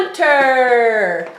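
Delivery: ordinary talk, nothing marked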